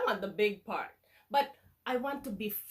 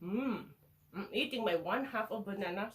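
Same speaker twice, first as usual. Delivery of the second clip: normal talking voice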